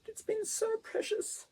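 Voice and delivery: high, tight voice on the edge of tears; choking up, fighting the tears; real tears rising in a soft, high voice; holding back tears. Real, not acted. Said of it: "Oh, I'm trying not to cry".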